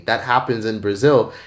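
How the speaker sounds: ordinary talk, nothing marked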